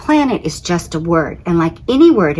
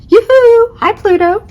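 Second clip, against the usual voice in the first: very high, loud sing-song call; flirty yoo-hoo; 7 dB louder than usual. Acted. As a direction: wave and call across space. Saturn calls out to Pluto.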